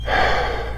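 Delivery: a long, quiet exasperated sigh; heavy sigh. Real, not acted. [name], worn out by Trump supporters, sighs quietly. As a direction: breathe out hard in disbelief.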